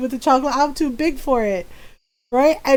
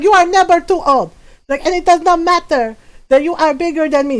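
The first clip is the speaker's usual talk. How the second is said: raised-pitch imitation of mother's telling-off; scolding mom; 4 semitones higher than usual. Mother scolding while whooping.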